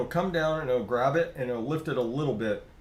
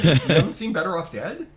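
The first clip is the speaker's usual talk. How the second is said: incredulous; 5 semitones higher, 7 dB louder than usual. Retold or imitated. Incredulous quote to friends.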